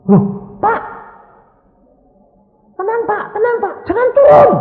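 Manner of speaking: high, loud flustered nurse voice; urgently restrain the patient, voice pitched high; imitated panicky nurse; alarmed nurse. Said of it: "susternya kan kebingungan".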